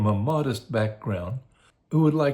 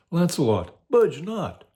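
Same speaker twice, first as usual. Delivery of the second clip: higher, louder conscience voice on budge not; a lightly voiced conscience pleading high; urging conscience; 8 semitones higher, 3 dB louder than usual. Conscience begs Lancelot to stay, higher urgent plea. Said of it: "my conscience says".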